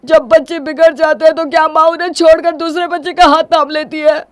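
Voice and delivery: high, loud, desperate reproach; anguished pleading. Acted. Husband begging wife not to leave, anguished.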